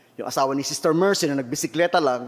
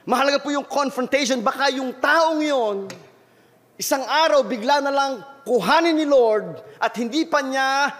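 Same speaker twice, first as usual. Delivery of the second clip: high, raised pastor's warning, pressing the point; fiery preaching; 9 semitones higher than usual. Acted. Pastor warns the congregation about sudden death, preaching high and hard. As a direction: urge the flock hard, voice lifted high.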